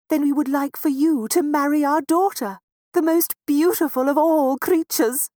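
high, loud proud mouse mother; a lightly voiced, proud mouse mother. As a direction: address the rain with pride. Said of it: "said Missus Mouse proudly".

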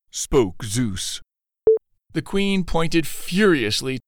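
ordinary delivery, nothing marked

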